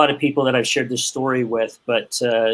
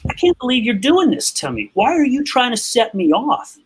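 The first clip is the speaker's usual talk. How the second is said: imitating his past rage, pitched much higher; retold outrage; 10 semitones higher than usual. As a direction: replay the fury at being set off, half-acted.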